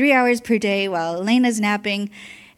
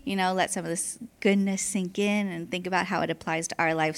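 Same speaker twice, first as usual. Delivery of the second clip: usual speaking voice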